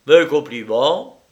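loud, lifted, rising at the end; big casual exclamation; breezy congratulations. A narrator's reading. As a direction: toss out congratulations brightly upward. Lyra's first reply, called out.

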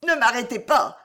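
usual speaking voice

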